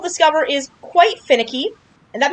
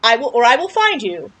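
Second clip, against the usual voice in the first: usual speaking voice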